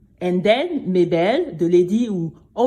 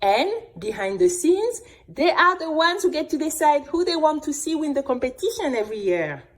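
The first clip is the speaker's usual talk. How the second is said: ordinary delivery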